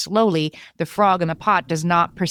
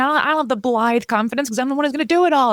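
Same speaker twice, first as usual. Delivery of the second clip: higher, fast exasperated rant; 7 semitones higher, faster than usual. Retold or imitated. Wife venting to spouse about doing all the work, fast exasperation. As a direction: rattle off the frustration. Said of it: "I'm like".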